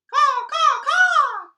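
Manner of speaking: very loud, high crow caws; cawing crows. Acted. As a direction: shriek like a crow. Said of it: "cried the crows".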